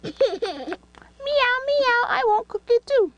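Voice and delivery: high meowing cat voice; kitty voice. Pretend. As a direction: squeak it like a playful kitten. Voicing the cat toy.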